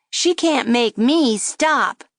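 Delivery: slow, high taunting puppet; defiant dummy. A narrator's reading. Dummy defying the girl, slow and taunting. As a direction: taunt her, drawing it out. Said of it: "the dummy said".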